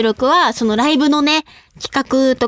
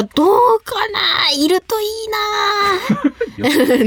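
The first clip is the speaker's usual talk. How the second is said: high, long-drawn wishful call; wishful musing; 7 semitones higher, much slower than usual. Real, not acted. Host longing for a big-footed new member, drawn-out wishful musing. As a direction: stretch each ending out high, half laughing. Wishing aloud for a same-size member.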